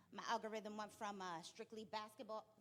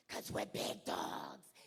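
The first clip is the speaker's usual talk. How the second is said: much lower, very slow growl; deep slow growl; 6 dB louder, much slower than usual. Retold or imitated. Coach hyping up her players in a deep slow growl. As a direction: drop the voice deep and drag it. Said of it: "And you wanna know why".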